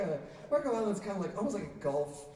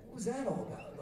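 mock indignant outburst, higher pitched; mad mom; 7 semitones higher, 4 dB quieter than usual. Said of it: "My mom is really mad".

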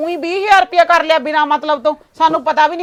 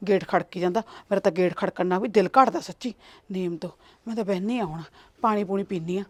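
shaken, breathless muttering; 7 semitones lower, 5 dB quieter than usual. Acted. Shaken woman muttering after escaping.

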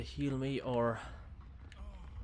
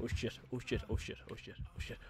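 breathless whispered panic; hushed, frantic repeating; panicked whisper; 3 semitones lower, 10 dB quieter than usual. Real, not acted. Hiding from killer, panicking.

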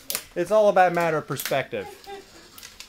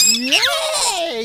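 much higher shouted yay; cheering squeal; 5 dB louder than usual. Real, not acted. Dad cheering the excited baby, shouted squeal. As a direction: cheer loudly.

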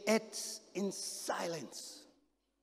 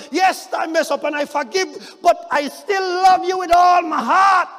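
high, loud, angry shout; angry protest; 9 semitones higher, 14 dB louder than usual. Acted. Peter angrily defending his love to Jesus, loud shout. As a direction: Peter hurt and furious. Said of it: "Peter get angry".

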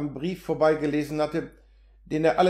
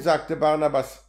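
ordinary delivery, nothing marked

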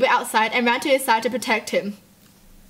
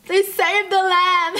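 slow and high, voice breaking with feeling; moved almost to tears by the rescue; tearful relief; choked up; 6 semitones higher, slower than usual. Real, not acted. Moved by lamb rescue clip.

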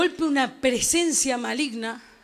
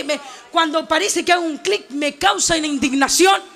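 ordinary delivery

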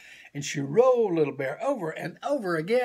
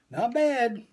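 higher, bright praise from mother bear; mother bear praises her cub, higher and encouraging; 8 semitones higher, 4 dB louder than usual. Acted. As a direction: lift the voice to cheer the cub on. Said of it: "said Mommy Bear".

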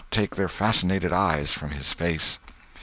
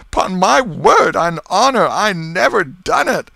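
loud, high-pitched desperate protest; sobbing; 13 semitones higher, 10 dB louder than usual. A narrator's reading. Accused man swearing innocence to the crowd, acted sobbing plea. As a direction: swear it in a tearful wail. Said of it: "he sobbed".

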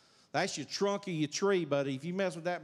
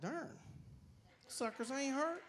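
higher, hushed disbelief; a man finding his sore feet healed, hushed and amazed; amazed exclamation; 8 semitones higher, 10 dB quieter than usual. Retold or imitated. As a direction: marvel at it under your breath, pitched up. Feet suddenly don't hurt.

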